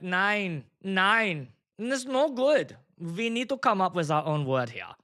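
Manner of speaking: emphatic mock German accent; hammed-up German objection. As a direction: refuse in a put-on German accent. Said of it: "he's got the German accent".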